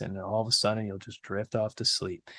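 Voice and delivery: hushed, drowsy and soothing; nice relaxing voice. Retold or imitated. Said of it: "in a nice, relaxing voice".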